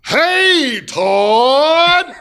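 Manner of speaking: imitating a young boy's drawn-out, high-pitched shout; really loud kid's holler. Friend's young son greeting him by phone, imitated loud holler. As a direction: holler it long and loud in an Alabama accent. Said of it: "really loud".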